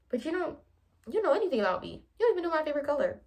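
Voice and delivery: a mock annoyed rush; annoyed rant. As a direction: rattle off the grievance in a huff. Annoyed retold complaint.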